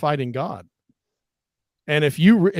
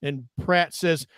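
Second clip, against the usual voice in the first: ordinary delivery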